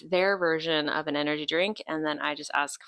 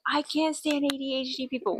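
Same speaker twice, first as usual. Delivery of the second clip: higher, put-on snooty voice; a hint of a snobby friend; mocking cohost; 7 semitones higher than usual. Mimicking her cohost's remark, mild snooty tone. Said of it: "She's like".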